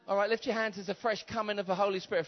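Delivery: ordinary talk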